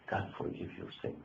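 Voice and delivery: whispered, almost breathless promise; whispered assurance. Real, not acted. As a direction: lean in and whisper the mercy. Sermon closing plea to repent.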